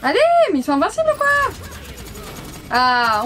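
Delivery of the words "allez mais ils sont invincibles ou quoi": fast, high, exasperated cry; exasperated outburst. Real, not acted. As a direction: blurt it out in disbelief. Frustrated at unbeatable enemy team.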